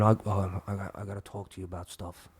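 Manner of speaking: low, very soft stoned mumble. Retold or imitated. Stoned friend wanting to talk, low soft mumble. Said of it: "He's like".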